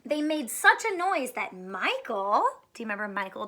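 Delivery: usual speaking voice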